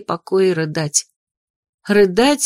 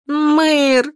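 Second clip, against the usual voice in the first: high, drawn-out anguished call; sobbing plea; 8 semitones higher than usual. A narrator's reading. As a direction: call out to him as if close to sobbing. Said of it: "прорыдала я".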